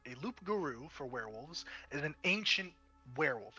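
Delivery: normal talking voice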